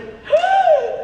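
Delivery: high, loud gasp; reenacted gasp. Fan reenacting their reaction to an episode, high gasp. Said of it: "I just went".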